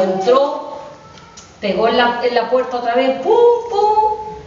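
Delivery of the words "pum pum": much higher, louder sung knock-knock; knocking sound. Acted. Wolf knocking a third time.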